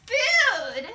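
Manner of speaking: high, loud announcing shout; excited shout. Acted. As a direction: shout it like a game show host. Host announces topic.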